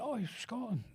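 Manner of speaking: high, light mimicry of a woman; imitating London shop assistant. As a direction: pitch it well up as the chemist's assistant. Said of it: "she didn't say in a Scottish accent".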